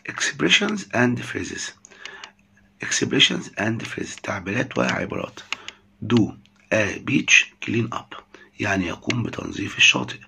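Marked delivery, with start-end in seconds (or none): 0.1-4.2 slow, careful English heading; slow dictation. Shown as a demonstration. Teacher reads the heading slowly to students. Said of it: "teacher reads the heading slowly". 6.1-8.2 very slow, careful English; slow model phrase. Shown as a demonstration. Teacher models the phrase slowly for students. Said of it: "teacher models the English phrase".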